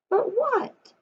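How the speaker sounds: usual speaking voice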